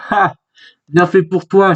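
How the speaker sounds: ordinary delivery, nothing marked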